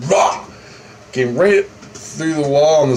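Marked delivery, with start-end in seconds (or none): 0.1-0.4 short, sudden mock roar; imitated demon roar. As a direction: roar like the thing lunging in. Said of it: "it came in and went".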